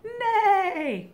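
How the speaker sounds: high loud whinnying neigh; horse neigh. Shown as a demonstration. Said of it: "the horse all sound like this".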